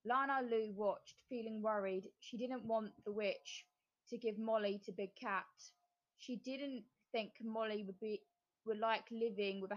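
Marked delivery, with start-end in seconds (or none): none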